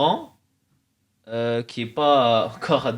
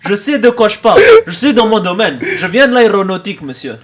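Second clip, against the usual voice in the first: put-on immigrant accent in French; thick immigrant accent in French; blédard accent; 5 semitones higher, 9 dB louder than usual. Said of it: "pourquoi j'ai pris un accent blédard".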